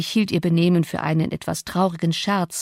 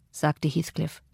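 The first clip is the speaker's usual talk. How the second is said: plain reading voice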